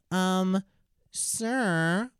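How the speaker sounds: high, drawn-out pupil voice; schoolkid. Retold or imitated. Kid with a hand up to the teacher, imitated schoolkid. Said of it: "And the kid said".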